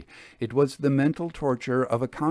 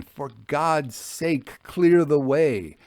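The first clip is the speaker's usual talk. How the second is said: slow, rising to a loud cry; desperate warning cry, slowly building; passionate plea; 4 semitones higher, 4 dB louder, slower than usual. Acted. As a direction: beg them to clear the way, loud and urgent. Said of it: "with increased passion and vehemence".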